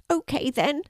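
high little creature voice; timid little dragon. A narrator's reading. Tiny dragon agrees to come out, timid high voice.

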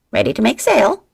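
the parrot mimicking the order; parrot squawk. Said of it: "Checkmate squawked".

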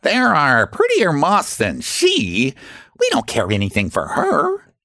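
mocking. A narrator's reading. Strangers flying away from Miss Cecropia, mocking. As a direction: taunt mockingly, stretching the words. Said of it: "they cried mockingly as they flew away".

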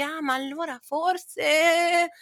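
drawn-out, high, dreamy "maybe"; slow, high mock wondering; mock hopeful musing. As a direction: stretch it out high and wistfully, as if daring to hope. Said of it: "quando c'è quel momento che dici".

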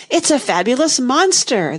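high-pitched unicorn exclamation; crying out. A narrator's reading. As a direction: cry it out with a lifted voice. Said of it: "the unicorn cried out".

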